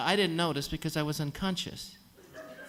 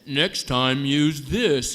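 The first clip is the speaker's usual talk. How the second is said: louder, curt mock-angry order; irritated nurse handing over a dish; annoyed nurse; 2 semitones lower, 8 dB louder, much slower than usual. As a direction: bark the order at the messy patient. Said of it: "the nurse was very mad".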